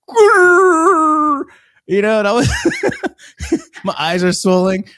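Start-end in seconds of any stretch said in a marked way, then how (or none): 0.1-1.4 high, loud fake blubbering wail; mock crying wail. Imitating his child self wailing for sympathy. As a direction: wail like a kid putting on tears. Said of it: "I was like".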